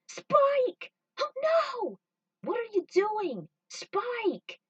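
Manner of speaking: very high, loud tearful cry; panicked crying call. Acted. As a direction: scream as he runs into the fire. Crew sees Spike run back.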